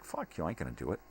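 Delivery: ordinary talk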